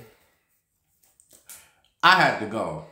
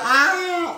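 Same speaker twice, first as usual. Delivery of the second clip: very high, loud mock shriek; imitated scream; 3 dB louder than usual. Frankie squealing at his friend in the stall, mock shriek. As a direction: let out a giddy squeal. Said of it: "screaming about".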